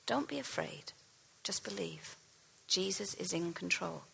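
quiet, dropping to a whisper on believe; hushed, tender repeat. Shown as a demonstration. Preacher repeating Jesus' words to the congregation, hushed. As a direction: say it softly so it sinks in. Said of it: "Let's hear that this morning".

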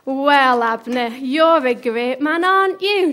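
loud mock praise, rising at the end; jeering, called-out taunt. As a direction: goad him from a safe distance. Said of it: "[name] taunted".

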